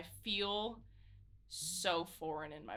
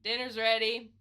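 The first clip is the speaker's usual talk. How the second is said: raised, louder call from the porch; yell; 5 semitones higher, 14 dB louder than usual. Acted. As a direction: call them in to dinner loudly. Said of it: "I just yell".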